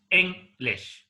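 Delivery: "English" broken into "Eng", pause, "lish"; split into syllables. Shown as a demonstration. Teacher showing that English has two syllables, syllable by syllable. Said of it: "English has two syllables".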